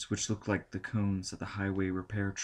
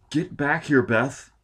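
louder, higher urgent call; urgent command; 6 semitones higher, 7 dB louder, slower than usual. A narrator's reading. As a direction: order her back now. Norman urgently ordering.

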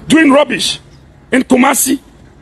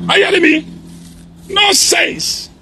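a higher, shouted dismissal with a falling end; angry scoff; 5 semitones higher, 3 dB quieter than usual. Real, not acted. Prophet dismissing a rival's claims, scornful shouted nonsense. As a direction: spit the word out in disgust.

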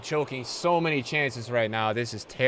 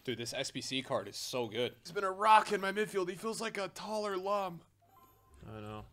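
usual speaking voice

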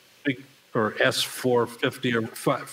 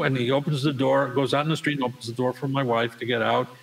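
normal talking voice

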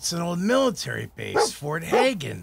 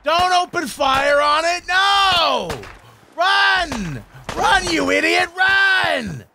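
panicked yelling; 6 dB louder, slower than usual. Real, not acted. Fleeing a monster in panic.

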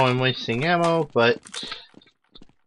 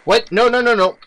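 panicked no; 9 semitones higher, 6 dB louder, faster than usual. Real, not acted. Game going wrong suddenly.

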